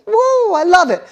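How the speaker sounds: high, loud whoop of delight; mock elation. Kid bragging about winning games, hammed-up high excitement. Mimics daughter elated at winning.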